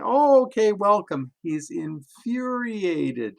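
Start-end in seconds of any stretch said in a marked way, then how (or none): 0.0-1.3 high, loud, sing-song fake welcome; mock friendly welcome. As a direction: play the polite greeting he never gave, much higher and brighter. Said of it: "instead of saying".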